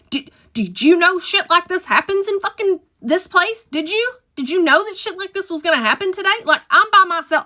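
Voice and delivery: high, loud, rushed pleading; frantic panic. Retold or imitated. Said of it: "And I'm like".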